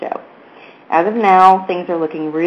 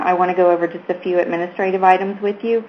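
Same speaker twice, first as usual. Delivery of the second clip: usual speaking voice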